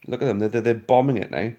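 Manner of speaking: normal talking voice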